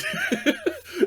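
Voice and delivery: real high laugh. Real, not acted. Laughing after telling the table he is almost dead, high burst.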